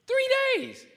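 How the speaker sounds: sudden very high squeal of disbelief; incredulous cry. Real, not acted. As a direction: shoot up high in outraged disbelief. Outrage at a short trial.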